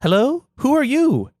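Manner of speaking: very high, loud sing-song greeting; Clytemnestra offstage. Acted. Mother greeting an unknown visitor, high and airy. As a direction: call out airily to a stranger. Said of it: "you hear Clytemnestra off stage saying".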